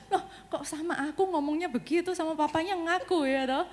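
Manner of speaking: mock-offended, half laughing; shocked laughing protest. Mom reacting to son who confessed only to dad, laughing in disbelief.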